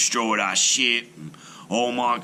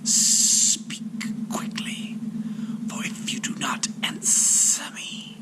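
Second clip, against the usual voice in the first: slow, airy serpent whisper; 8 semitones higher, 4 dB quieter, slower than usual. A narrator's reading. Serpent threatens the shipwrecked sailor in a whisper. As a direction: breathe it out like an ancient snake. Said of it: "The serpent spoke".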